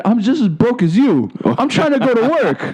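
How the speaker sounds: indignant retort. Retold or imitated. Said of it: "I said".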